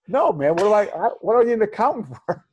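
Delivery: put-on high, fast, incredulous young self; retelling a call with a mentor, high and incredulous; naive young self.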